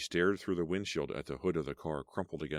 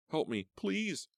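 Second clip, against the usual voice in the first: higher and slow, pleading; high drawn-out plea for help; desperate plea; 12 semitones higher, slower than usual. Acted. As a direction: beg the driver to stop. Begging the car to stop.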